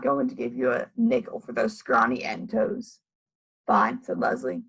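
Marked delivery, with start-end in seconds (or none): 0.0-3.0 low, different gruff man voice; low gruff Louis. Acted. 3.7-4.0 curt low fine. Acted. Leslie curt.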